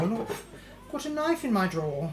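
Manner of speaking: normal talking voice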